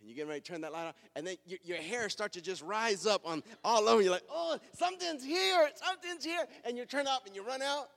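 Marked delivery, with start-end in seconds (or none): 4.3-6.4 high, loud mock fright; panicked alarm. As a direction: cry out as if spooked in the dark. Acts out sudden fright in dark.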